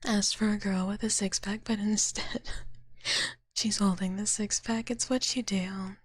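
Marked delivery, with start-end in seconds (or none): none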